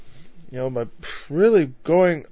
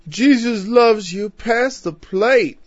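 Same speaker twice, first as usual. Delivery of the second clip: slow, high Southern preacher drawl, louder; Southern huckster preacher; 8 semitones higher, 4 dB louder, much slower than usual. Retold or imitated. Preacher mocks a TV huckster asking for the plate, in a slow Southern accent. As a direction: ham up a Southern televangelist begging for money. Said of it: "resorting twice in one study to a Southern accent".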